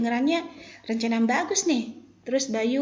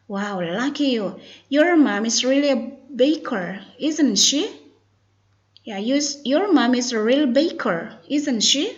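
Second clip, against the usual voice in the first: slow, louder careful reading; model dialogue reading; 3 semitones higher, 6 dB louder, slower than usual. Shown as a demonstration. Teacher reads Santi's admiring reply for students, slowly and emphatically. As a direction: model the tag question clearly for learners. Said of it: "focus to pronunciation and intonation".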